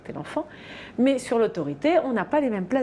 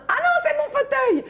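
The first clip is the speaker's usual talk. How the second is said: much higher mock child yell; child's tantrum. Nanny imitating the girl yelling about her armchair, much higher mock yell. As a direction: shriek it like a possessive five-year-old. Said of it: "elle a hurlé".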